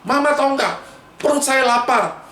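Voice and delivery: high-pitched cross complaint to mom; mock angry child; angry demand. Shown as a demonstration. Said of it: "Jangan marah-marah".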